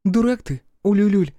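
a mild telling-off; stern. A narrator's reading. Olli lightly scolds Ululul for spoiling the mood. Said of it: "строго сказала Оля".

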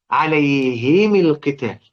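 عليهم القتال drawn out very slowly as alayhimi l-qital; slow recitation demo. Shown as a demonstration. Teacher showing students the harmony of kasras, very slow. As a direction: stretch عليهم, kasra on the ha and the mim. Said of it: "اللي هو إيه؟".

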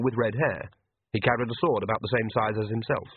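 ordinary delivery

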